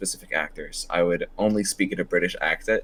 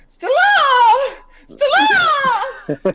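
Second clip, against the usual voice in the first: screeching shout, pitched way up; Marlon Brando; 7 dB louder than usual. Acted. Re-enacting a stage line, calling out for Stella, screeching. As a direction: scream it out, high and all-out. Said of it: "Look at this dumb fuck's just screeching".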